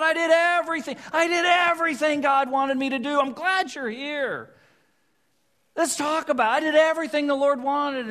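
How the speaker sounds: high, loud, overeager buddy voice; cheerful greeting. Acted. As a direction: gush like a king sure he did nothing wrong. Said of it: "Saul greets him cheerfully".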